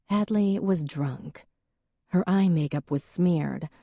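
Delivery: ordinary delivery, nothing marked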